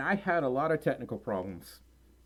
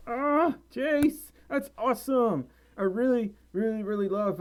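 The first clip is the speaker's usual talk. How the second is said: much higher and louder, gushing; excited; 8 semitones higher, 4 dB louder than usual. Real, not acted. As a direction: gush with delight at the fan. Excited by chat praise.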